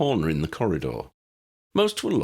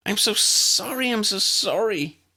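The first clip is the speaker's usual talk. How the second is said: anxious apology; 3 dB louder than usual. A narrator's reading. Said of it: "Tally was saying over and over".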